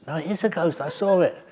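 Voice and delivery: high-pitched imitated protest; retold insistent villager. Villager argues with a doubter over a ghost, high mock insistence. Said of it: "I heard the conversation".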